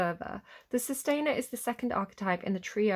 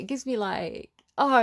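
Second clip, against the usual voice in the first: ordinary talk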